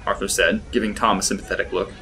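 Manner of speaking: plain reading voice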